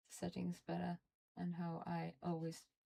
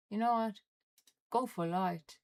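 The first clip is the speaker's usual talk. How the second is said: loud defiance; 3 semitones higher, 9 dB louder than usual. Real, not acted. Defiant decision, sudden loud.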